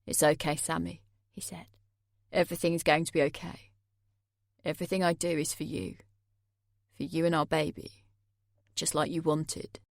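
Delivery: calm her with unsettling softness; Jay soothing. A narrator's reading. Jay hovering over the narrator, soothing in a low quiet voice.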